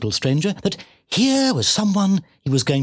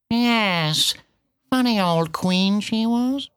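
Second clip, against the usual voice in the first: high, drawn-out cat voice; bitten-off words; 7 semitones higher, much slower than usual. A narrator's reading. Cat curtly questioning the piglet, words bitten off. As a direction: bite each word off and spit it out. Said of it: "seemed to bite off its words and spit".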